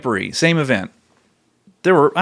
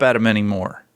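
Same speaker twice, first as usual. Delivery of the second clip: normal talking voice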